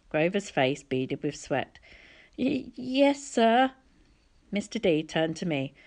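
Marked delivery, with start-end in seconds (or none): 2.4-3.7 nervous stutter. A narrator's reading. Scared satyr.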